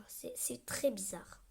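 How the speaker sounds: slow whisper, barely voiced; slow hushed whisper; whispered aside. Real, not acted. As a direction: whisper it, weirded out. Aside about strange face.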